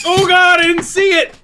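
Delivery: high, loud cry of surprise; startled yell. Real, not acted. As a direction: yell it, caught off guard. Sudden near crash with dog.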